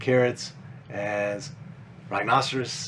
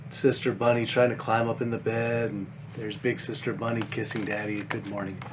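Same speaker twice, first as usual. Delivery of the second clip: ordinary delivery, nothing marked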